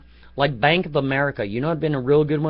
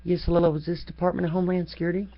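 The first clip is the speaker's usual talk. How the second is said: quick, slightly higher imitation of a woman calling; a hint of a prim caller; mock phone call; 5 semitones higher, faster than usual. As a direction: lightly imitate her hurried secret call. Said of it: "what she probably did is one of these".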